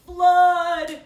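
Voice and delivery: very loud alarmed shout. Acted. Father bear shouting at the flooded bathroom, full shout. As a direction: yell it out in alarm. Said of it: "shouted Mister Blair".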